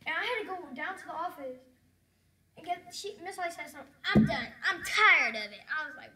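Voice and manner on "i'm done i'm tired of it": loud fed-up outburst; exasperated, much louder than usual talk; retold outburst. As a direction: burst out loudly, completely fed up. Acted outburst retold to stream.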